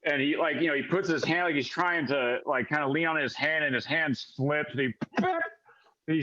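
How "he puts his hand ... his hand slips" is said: ordinary talk, nothing marked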